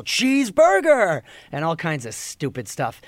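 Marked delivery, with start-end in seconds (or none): 0.0-1.2 long, high, loud heckling holler; imitated fan yell. Rowdy fans yelling a golfer's nickname, drawn-out high shout. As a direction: stretch the word out in a mock crowd shout. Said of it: "and they would yell".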